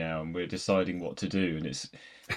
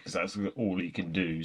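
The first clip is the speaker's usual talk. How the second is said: ordinary talk, nothing marked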